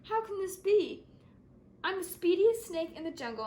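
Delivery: frantic wail of disbelief; panicked disbelief. Acted. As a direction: cry out in dismay. Snake panics turning green.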